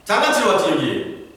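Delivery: high-pitched, irritated telling-off; acted big-brother rebuke, dropping at the end; scolding older brother. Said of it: "형이 와서 다윗을 야단쳤습니다".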